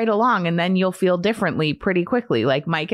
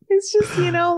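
high, strained voice breaking into tears; tearful crying outburst. Real, not acted. Mother tearing up about kids leaving for college, crying out.

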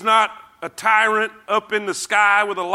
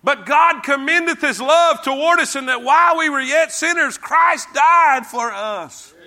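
shouted scripture; 7 semitones higher, 3 dB louder than usual. Acted. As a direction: thunder the verse out to the crowd. Preacher shouting a scripture verse.

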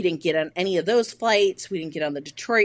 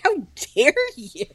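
high, offended gasp of a line; hammed-up affront; mock indignation; 9 semitones higher than usual. Angry retold phone call with husband.

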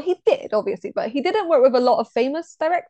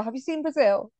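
ordinary talk

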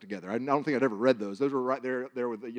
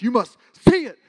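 loud, higher booming command; 10 semitones higher, 14 dB louder than usual. Retold or imitated. Dream voice loud enough to wake the sleeper, booming. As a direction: proclaim it so it rattles the glass. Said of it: "it was so loud when he goes".

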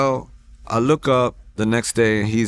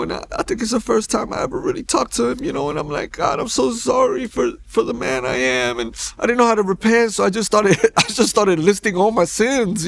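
real high, emotional voice; choked up; 10 semitones higher than usual. Real, not acted. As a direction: hold back tears while confessing. Emotional recalling first prayer of repentance.

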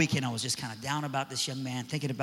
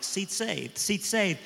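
higher, clipped mock kid voices; dismissive seat saving; 5 semitones higher, much slower than usual. Students brushing off the mean kid, mock dismissive. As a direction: brush him off, seat taken. Kids saving seats on bus.

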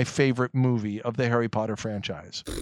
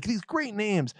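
usual speaking voice

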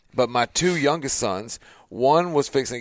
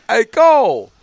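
loud high shout of a name; dad shouting a warning; yell; 11 semitones higher, 10 dB louder, slower than usual. Retold or imitated. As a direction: yell across the field to warn him. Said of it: "I yell out".

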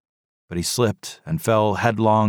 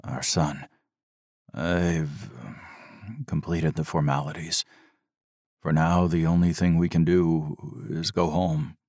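monotone; 2 semitones lower, 4 dB quieter than usual. A narrator's reading. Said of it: "His voice was monotone".

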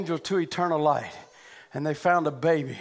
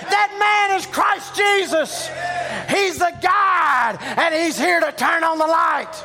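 higher, louder fervent preaching with shouts; preaching at full force; 11 semitones higher, 4 dB louder than usual. Real, not acted. Pastor exhorting the congregation about the light switch, full-force preaching. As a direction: preach it out to the back row.

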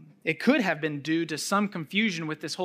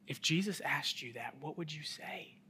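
whispered aside to the congregation; hushed whisper; 8 dB quieter than usual. Real, not acted. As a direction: draw them in with a whisper. Preacher asks listeners intimately.

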